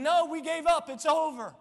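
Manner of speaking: loud, high objection; protesting. Acted. Mourners answering the Lord's claim, loud protest. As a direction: insist that all is lost.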